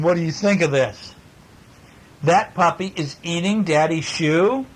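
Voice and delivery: plain reading voice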